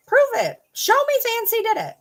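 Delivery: indignant dare; defiant challenge. Real, not acted. Challenging a critic to prove it.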